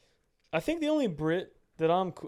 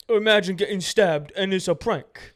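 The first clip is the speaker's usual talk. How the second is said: higher, louder mock posh British voice; mock British accent; 4 semitones higher, 6 dB louder than usual. Podcast host mocking British banter in a British accent. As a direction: put on a British accent to tease. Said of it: "if we were a British podcast".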